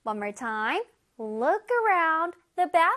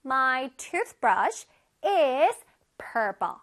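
I wash my hands with soap, each word drawn out for the class; very slow model reading; 3 semitones lower, much slower than usual. Shown as a demonstration. Teacher reads the example sentence slowly to the children. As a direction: read it slowly so children can follow. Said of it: "우리 한번 다시 해보자".

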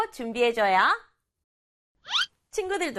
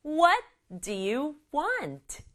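higher, slow question with "What" and "want" marked; stress on WHAT and WANT; 5 semitones higher, much slower than usual. Shown as a demonstration. Teacher leads children in practice, stressing "What" and "want". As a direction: lift "What", space it out, fall on "want". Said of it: "원하니가 중요해요".

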